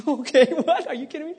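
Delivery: high, laughing what; amused shock at the drama; laughing disbelief. Real, not acted. Preacher reacting to Jonah's prayer, laughing high disbelief.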